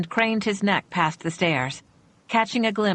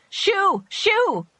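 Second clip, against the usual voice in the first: shriek; 14 semitones higher than usual. Acted. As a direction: shriek to scare them off. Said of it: "she shrieked".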